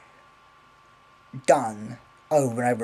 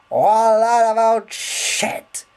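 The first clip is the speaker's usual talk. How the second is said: mock disgust; 8 semitones higher, 8 dB louder, slower than usual. Mocking Birds Eye nuggets.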